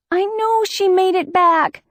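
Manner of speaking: panicked insistence; pleading. Acted. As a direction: beg them to believe you. Pleading with friends to believe.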